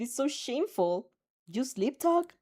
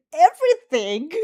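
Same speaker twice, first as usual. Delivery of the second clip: louder, excited squeal; 6 semitones higher, 10 dB louder than usual. Acted. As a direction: squeal it out gleefully. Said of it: "She squeals".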